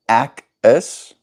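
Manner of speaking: "Ak is" with an open A as in "ack"; speaker's own pronunciation. Shown as a demonstration. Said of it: "where I would say".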